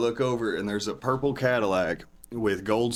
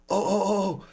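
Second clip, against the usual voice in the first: mock excited squeal, much higher; retold excited yell; 9 semitones higher than usual. Dealer from Chicago recognising the meth, hammed-up high excitement. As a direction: imitate a dealer going wild with discovery. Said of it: "screaming this shit".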